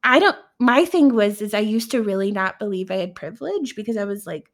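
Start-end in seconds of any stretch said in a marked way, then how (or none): 0.0-0.4 high-pitched protesting excuse; mock defensive. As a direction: push back as if wrongly blamed, pitched up.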